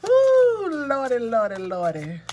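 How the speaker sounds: high, loud excited ooh then lordy. Real, not acted. Tarot reader thrilled by a card, squealing. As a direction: exclaim in delight at the cards.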